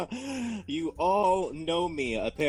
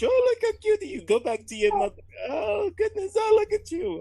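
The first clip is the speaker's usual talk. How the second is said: much higher, fast cooing to a kitten; cooing baby talk; 5 dB louder, faster than usual. Acted. Hero cooing at a tabaxi kitten, high baby talk. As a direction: gush over the little one like a doting adult.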